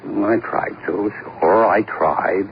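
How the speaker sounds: slow, dragged-out confession; reluctant admission. Acted. Suspect owning up to the detective, slow and reluctant. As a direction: let it out unwillingly.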